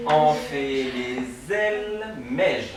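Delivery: Enfilé, zèle, mège spelled out slowly; slow, syllable by syllable. Shown as a demonstration. Teacher spells the reversed sounds for the class, slowly. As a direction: break it into slow separate chunks. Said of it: "il faut qu'on fasse la liaison".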